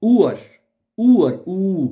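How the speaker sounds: ऊअर said as German "Uhr" with a long rounded u. Shown as a demonstration. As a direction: stretch ऊअर slowly as "oo-er". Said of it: "लफ्ज भी लिखा हुआ है". (German "Uhr" का उच्चारण)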